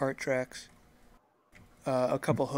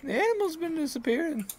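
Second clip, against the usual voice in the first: odd farmer voice; 4 dB louder, faster than usual. Acted.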